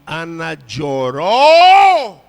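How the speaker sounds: slow, swelling shout; drawn-out, full-force pulpit delivery; preaching shout. Real, not acted. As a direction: stretch the last word into a loud cry. Preacher building to a cry.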